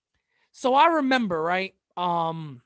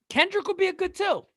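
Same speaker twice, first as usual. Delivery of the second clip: mock high voice; 10 semitones higher than usual. Repeats in a higher voice.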